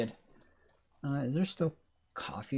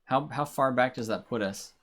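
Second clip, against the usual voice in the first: ordinary talk, nothing marked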